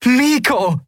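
high, joyful call; elated high-pitched greeting; jubilant. A narrator's reading. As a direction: cry his name overjoyed. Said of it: "jubelte Endriel".

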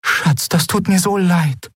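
very high, distressed condolence; shocked sympathy. A narrator's reading. Mother to her bereaved daughter, distressed sympathy. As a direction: console her while aghast yourself. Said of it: "Ihre Mutter hielt entsetzt".

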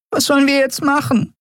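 high, desperate tiny voice. Acted. Nelen asks the crew what now, desperate and high. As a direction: plead for a plan. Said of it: "fragte sie verzweifelt".

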